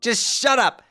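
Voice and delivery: loud, high rising tirade; Karkat-style yelling. Acted. Jade ordering her crybaby self to fight, loud tirade. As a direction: build the shout to Jack Noir. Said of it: "Jade has turned into Karkat".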